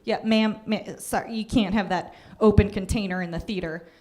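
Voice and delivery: deep awkward usher; hesitant low-voiced usher. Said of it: "The usher would be like".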